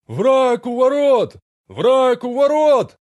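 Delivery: far-off ringing cry echoing down corridors; distant echoing shout. Acted. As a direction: shout from far away, echoing through halls. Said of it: "Далёкий звенящий крик раскатился эхом по коридорам". (далёкий звенящий крик с эхом)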